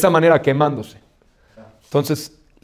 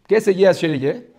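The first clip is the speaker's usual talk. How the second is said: ordinary talk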